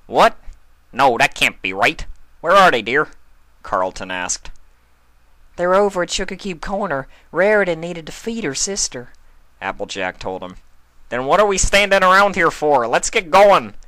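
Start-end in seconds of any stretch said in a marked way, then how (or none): 0.0-3.1 louder, sudden alarm; an uncle hearing bad news; louder alarmed question; shocked stallion. Carlton shocked. 5.6-9.1 higher, louder, rushed; a busy pony apologizing on the run; louder and higher hurried country mare; hurried farm pony. Acted. Narrator voices Applejack. 11.1-13.7 much louder, rallying; an uncle rushing to help; loud call to go; urgent stallion. Acted. Carlton urgent.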